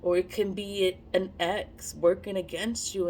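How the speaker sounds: ordinary talk, nothing marked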